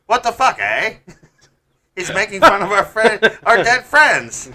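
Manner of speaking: hammed-up offended Canadian family; outraged Canadian. Victims' families outraged at the podcast in Canadian accent. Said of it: "families listening and they're like".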